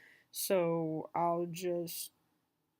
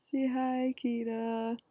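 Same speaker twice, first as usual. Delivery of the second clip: higher, drawn-out coaxing voice for a pet; talking to a dog; 5 semitones higher, slower than usual. Real, not acted. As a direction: coax the dog to say hi.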